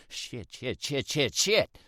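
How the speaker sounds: stuttered curses rising into a yelp as he tips forward; losing balance, stammering swears; panicked stutter. Acted. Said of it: "I stutter".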